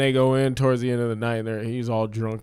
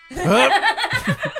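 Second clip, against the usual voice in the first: high strained hup, laughing; mock grunt; 8 dB louder than usual. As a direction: squeeze out a silly high hup. Imitating movie grunt.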